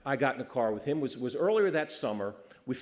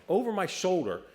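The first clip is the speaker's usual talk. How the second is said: usual speaking voice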